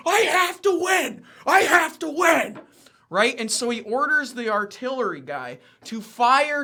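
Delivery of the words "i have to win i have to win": much higher, louder frantic mock yell of a general; Cornwallis desperate shout. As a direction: scream it like a general who can't bear to lose. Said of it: "sees this and goes".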